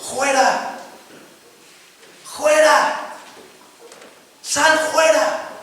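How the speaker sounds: high, commanding cry of fuera; commanding exorcism shout. Retold or imitated. Humble preacher ordering the demon out, commanding shout. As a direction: cast the demon out with authority.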